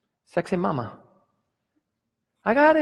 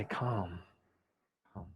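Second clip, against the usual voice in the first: soft soothing hush; 6 semitones lower, 13 dB quieter than usual. Acted. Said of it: "God can say".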